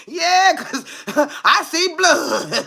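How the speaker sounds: high, slow cackling delight; hammed-up giddy laugh at blood; laughing mock glee. Taunting the boyfriend with laughing glee at blood. Replays her crazed reaction.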